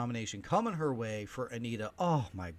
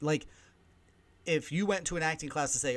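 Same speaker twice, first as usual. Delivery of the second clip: usual speaking voice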